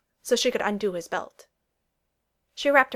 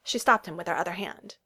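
ordinary delivery

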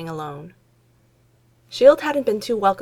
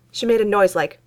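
ordinary delivery

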